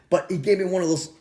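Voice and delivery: ordinary delivery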